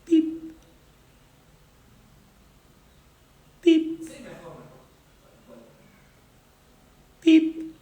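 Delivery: high, thin beep sound like a monitor; short high electronic beep; imitated machine beep. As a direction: mimic a hospital monitor beep. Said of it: "I hear a beeping noise".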